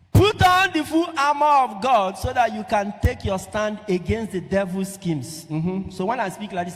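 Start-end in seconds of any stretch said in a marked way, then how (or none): 0.1-5.4 high, loud scripture proclamation; raised preacher's voice; forceful preaching. Real, not acted. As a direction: thunder the verse to the congregation.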